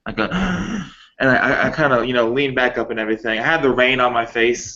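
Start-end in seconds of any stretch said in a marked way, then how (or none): 0.3-0.9 a sharp, high, hammed-up sob gasp; mock sob caught in the throat; fake sobbing gasp. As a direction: gasp in like you're faking a sob. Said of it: "a fake sobbing sound".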